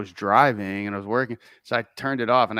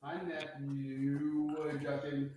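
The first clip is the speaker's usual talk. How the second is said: cave echo; 4 semitones higher, 15 dB quieter, much slower than usual. Real, not acted. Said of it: "you sound like you're in a, uh, cavern".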